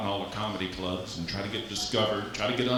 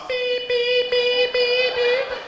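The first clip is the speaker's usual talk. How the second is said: high piercing reversing beeps; shrill beep of a backing garbage truck; 6 dB louder, much slower than usual. Retold or imitated. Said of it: "they back up to get to your house".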